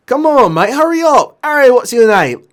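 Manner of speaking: high, loud British lad hurrying a mate; impatient shouting. Acted. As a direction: holler as the frantic patient. Patient hurrying his helper along.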